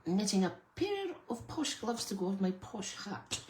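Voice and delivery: playfully putting on airs; posh voice. Acted. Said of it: "Why did I say that in a posh voice?".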